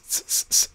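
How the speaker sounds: hissing s, s, s; sibilant s sounds. Shown as a demonstration. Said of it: "really bright S's".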